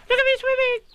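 very high, tiny squeaking voice; squeaky Roo. A narrator's reading. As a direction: squeak it like a proud baby kangaroo. Roo's voice.

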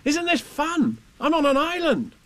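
put-on high-pitched, delighted boy; Christopher Robin, thrilled. A narrator's reading. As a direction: exclaim it like an excited little boy. Boy delighted by his island.

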